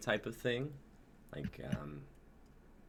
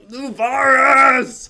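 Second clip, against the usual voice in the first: long, drawn-out triumphant yell; stretched, high, very loud cheer; cheering shout; 21 dB louder, much slower than usual. Real, not acted. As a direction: belt the name out like a victory cry. Cheering the show's name.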